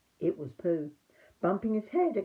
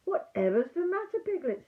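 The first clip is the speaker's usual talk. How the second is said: Christopher Robin; 10 semitones higher, 3 dB louder than usual. Acted. Christopher Robin asks worried Piglet.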